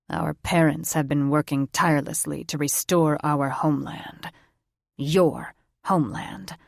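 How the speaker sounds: a grandson's bitter dig at his grandparents; bitterly. A narrator's reading. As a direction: spit it back resentfully. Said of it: "Drake said bitterly".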